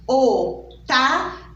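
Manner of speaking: slow "Otávio" with a closed O. Shown as a demonstration. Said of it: "Observem o som da letra O". ("Otávio" com O fechado, devagar)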